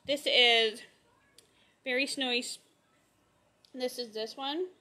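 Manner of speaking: ordinary talk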